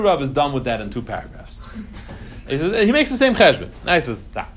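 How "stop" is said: ordinary talk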